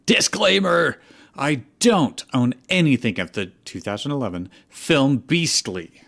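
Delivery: hammed-up fanfic disclaimer. Shown as a demonstration. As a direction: proclaim the disclaimer like an eager young fic writer.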